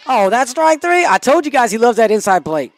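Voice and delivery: loud, high, indignant call; exasperated outburst. Real, not acted. Protests strike three call.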